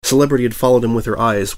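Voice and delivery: usual speaking voice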